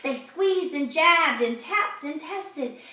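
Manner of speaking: plain reading voice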